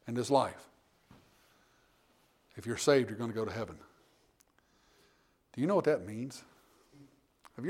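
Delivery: tender hushed preaching; soft sincere. Real, not acted. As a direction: drop to a soft, hushed pastoral voice. Soft intimate pastoral aside.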